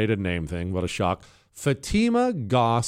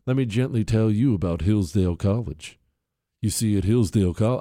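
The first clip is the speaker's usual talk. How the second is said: put-on gentle, slightly lower sales pitch; loving voice; 3 semitones lower than usual. Host gently tells listeners about Hillsdale College, mock loving. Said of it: "I can't do the loving voice for too long".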